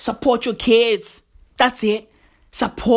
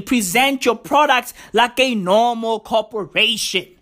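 ordinary talk, nothing marked

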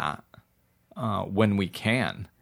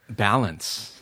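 ordinary delivery

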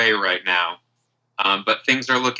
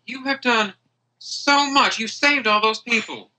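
higher-voiced, effusive friend; grateful [name]; 6 semitones higher than usual. Hungover friend thanking the one who saved everyone, grateful. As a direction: gush with thanks in a lifted voice.

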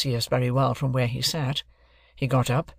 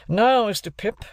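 raised, deliberate lawyer's voice; stern lawyer; 9 semitones higher, 4 dB louder than usual. Acted. Jaggers introducing himself to Joe and Pip, stern lawyer. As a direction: announce yourself like a cool London lawyer.

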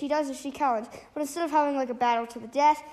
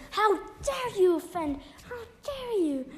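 higher-pitched indignant cry; mock outrage; 7 semitones higher than usual. Storyteller voices Glory confronting the attacker, mock outrage. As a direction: play-act an indignant challenge.

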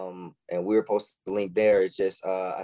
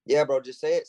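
usual speaking voice

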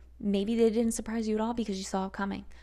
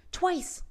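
"Twice." high and punched; emphatic repeat; 10 semitones higher than usual. Real, not acted. Vlogger to viewers, stressing she read it twice, emphatic. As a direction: slam down "Twice." as proof.

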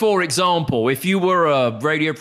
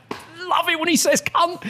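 put-on imagined old relative; 13 semitones higher than usual.

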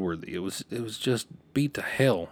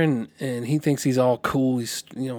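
normal talking voice

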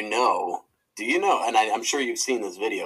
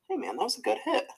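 friendly compliment; 13 semitones higher than usual. Retold or imitated. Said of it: "and he's just like".

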